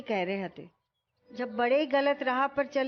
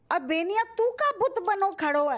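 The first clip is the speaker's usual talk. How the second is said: urgent shout; 6 semitones higher than usual. Acted. Urging someone to stop wife.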